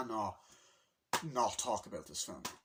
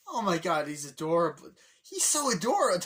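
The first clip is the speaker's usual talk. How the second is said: higher, louder adoring squeal; mock gushing; 5 semitones higher, 6 dB louder than usual. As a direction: coo over something impossibly cute. Everyone gushing over cat Mario.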